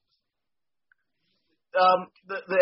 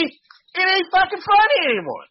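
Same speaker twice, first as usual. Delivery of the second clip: ranting; 11 semitones higher, 3 dB louder than usual. Real, not acted. Ranting about an old song.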